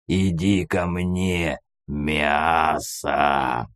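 slow, low predator growl; menacing growl. A narrator's reading. Hero luring a mutant closer, low drawn-out growl. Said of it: "зарычал я".

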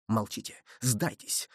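low, soft murmur; whispered urging. A narrator's reading. Man secretly urging Athos to surrender, murmuring. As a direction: keep it down, for one ear only. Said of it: "прошептав ему на ухо".

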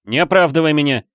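high, loud angry protest; furious. A narrator's reading. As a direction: cut off the excuses angrily. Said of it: "яростно жестикулируя". (яростный протест)

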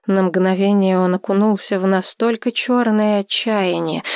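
plain reading voice

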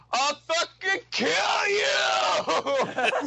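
high, drawn-out mock yell; stretched, hammed-up threat, breaking into laughter; retold shout to the sky. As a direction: imitate a madman hollering at the heavens. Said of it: "he shouts up to the sky".

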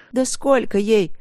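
indignant demand. A narrator's reading. Wife asks husband the girl's age, indignant. Wife rages about the other woman.

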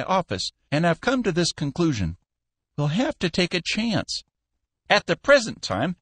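put-on a crafty old man steering his manager; lightly voiced old boss, firm but friendly; smooth old Cappy. Cappy instructing Skinner.

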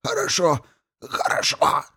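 high, half wheeze, half gurgle; wheezing gurgle. A narrator's reading. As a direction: rasp and gurgle it out. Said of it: "наполовину прохрипел, наполовину пробулькал".

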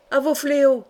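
rally the men out loud; rallying cry. A narrator's reading. Peasant calls men to arms. (cri de ralliement)

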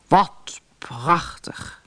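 slow, breathy wonder from a king; awed exclamation. A narrator's reading. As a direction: draw it out in hushed amazement. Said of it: "riep de koning".

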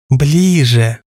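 trembling, rattling voice; quavering voice. A narrator's reading. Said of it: "дребезжащим голосом потребовала Юлька". (дребезжащий голос)

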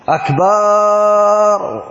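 أكبر with the first vowel drawn out long and slow; stretched vowel. Shown as a demonstration. Teacher mocking a muezzin's drawn-out vowel. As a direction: drag out "أكبر" as the wrong way. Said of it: "أنت ليش دا تسويها آه".

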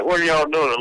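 normal talking voice

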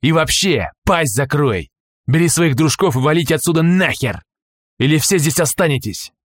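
harsh barking commands. Acted. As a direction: bark orders like a thug. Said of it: "рявкнул на него охранник".

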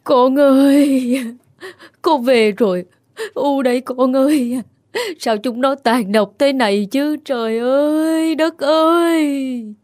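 high, slow keening wail of a grieving mother; anguished wailing. Acted. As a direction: cry out to heaven over your dead son. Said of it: "Bà gào lên một tiếng thê thiết".

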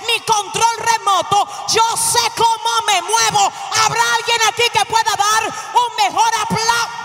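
defiant, full-voiced preacher's cry; fiery preaching. Real, not acted. Preacher defies whoever would hold their remote control, fiery.

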